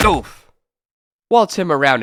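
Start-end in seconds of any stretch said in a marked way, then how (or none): 0.1-0.5 grunt of pain. Acted. Punched in fight.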